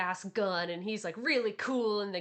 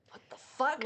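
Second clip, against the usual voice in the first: shocked what the fuck; 5 semitones higher than usual. Retold or imitated. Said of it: "you're like".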